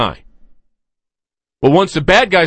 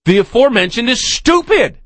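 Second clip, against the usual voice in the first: exasperated; 8 semitones higher than usual. Real, not acted. Host ranting about guest.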